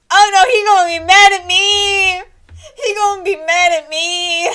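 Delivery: loud, wailing mock panic. [name] panicking at seeing Groven, fake wailing. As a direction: fret like a scared kid caught out.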